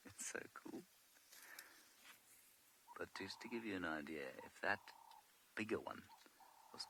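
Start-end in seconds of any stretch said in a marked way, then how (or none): 0.1-0.8 whisper. Real, not acted. Near elephants, keeping quiet.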